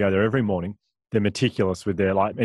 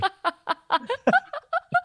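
corny dad laugh. Real, not acted. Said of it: "That's such a dad joke".

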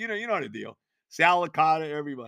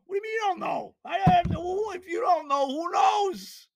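incredulous shouting; 10 semitones higher than usual. Retold or imitated. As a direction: shout it, much higher, in disbelief. Retold exchange with coach, shouting.

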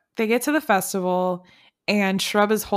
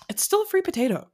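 high mock insistence; 11 semitones higher, faster than usual. Repeating Kelly's line while laughing.

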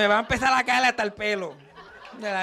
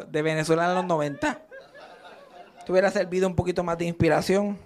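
ordinary talk, nothing marked

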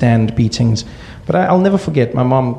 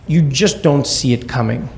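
usual speaking voice

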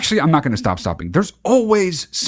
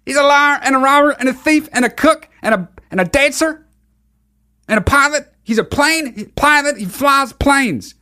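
high Southern preacher parody, list rolling on; mocking Greg Locke; 8 semitones higher than usual. Host riffing on preacher's accusations, mock sermon. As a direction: preach an absurd list of trades, pitched up. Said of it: "How do people still talk like that?".

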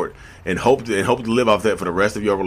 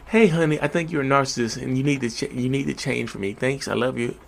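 soft and much quieter, tender and kind; imagined wife asking her husband nicely to change, soft and tender; gentle, sweet wife voice; 6 dB quieter than usual. Pretend. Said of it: "if my wife came to me and say".

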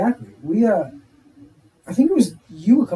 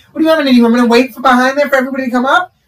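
louder, higher, exasperated; indignant; 6 semitones higher, 6 dB louder, faster than usual. Retold or imitated. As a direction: argue back, fed up. Retold argument with cashier.

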